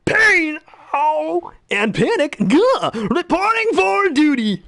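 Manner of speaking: panicked squeaky henchman; Bobcat Goldthwait. Retold or imitated. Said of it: "Bobcat Goldthwait".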